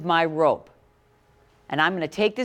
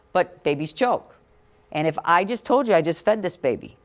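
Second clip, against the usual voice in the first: normal talking voice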